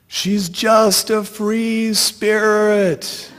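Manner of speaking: high, slow mock yell. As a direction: call it out drawn long. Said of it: "I'm yelling".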